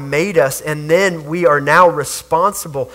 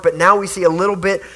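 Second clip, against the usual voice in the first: normal talking voice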